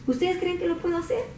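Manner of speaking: calm and gentle. Acted. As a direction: ask as if nothing were wrong. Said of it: "Como bien quitado la pena". (pregunta calmada, sin preocupación)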